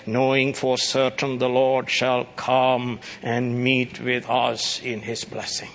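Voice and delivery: ordinary delivery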